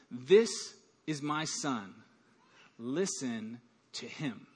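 God speaking from the cloud, low and slow. A narrator's reading. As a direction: speak it low and solemn from above. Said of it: "a voice comes from the cloud".

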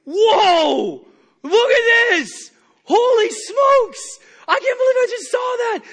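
very high, loud, slow astonishment; amazed exclaim. Retold or imitated. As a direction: gasp in wonder at the miracle. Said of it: "I'd be".

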